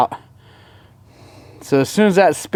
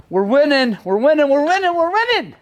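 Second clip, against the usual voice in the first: each repeat climbs higher, rising to a squeal; building excitement; 11 semitones higher than usual. Real, not acted. Rider chanting to his viewers over the good news, building from excited to squealing high. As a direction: chant it with growing glee.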